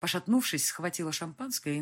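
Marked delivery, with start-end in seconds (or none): none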